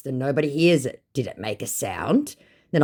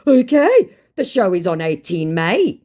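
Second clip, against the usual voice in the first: mock scammer; 6 semitones higher, 7 dB louder than usual. Scammer reply, acted.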